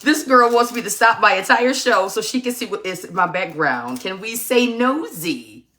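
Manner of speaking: normal talking voice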